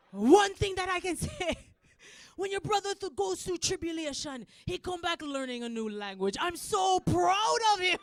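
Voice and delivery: high, loud Jamaican dad impression; Jamaican accent. Dad boasting about his son's new language, Jamaican accent. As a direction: declare proudly in a Jamaican accent. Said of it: "This is Jamaican accent".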